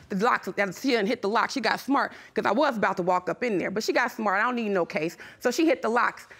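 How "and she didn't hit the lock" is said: ordinary delivery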